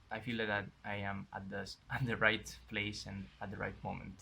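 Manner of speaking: ordinary delivery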